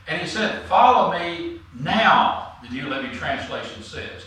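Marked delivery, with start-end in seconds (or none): 0.7-2.5 "now" set apart and hit hard; stress on now. Retold or imitated. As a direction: draw out "Follow me", then land firmly on "now". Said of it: "to emphasize".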